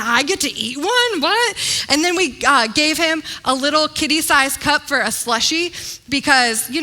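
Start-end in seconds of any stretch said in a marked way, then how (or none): 0.0-1.6 put-on amazed toddler. Toddler thrilled by giant popcorn.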